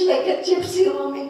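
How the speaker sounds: ordinary talk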